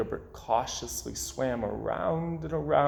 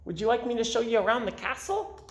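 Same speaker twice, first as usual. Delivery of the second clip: 1 eager fish voice; 9 semitones higher, much faster than usual. Acted. Gilbert offers a tour.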